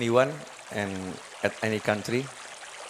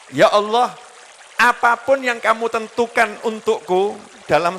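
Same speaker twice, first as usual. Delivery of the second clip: high, loud, pleading prayer to God; a supplicant begging the Lord; earnest prayer; 8 semitones higher, 5 dB louder than usual. Acted. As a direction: cry out the prayer, raised and imploring. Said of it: "Bahasanya Nabi ... sopannya sama Allah".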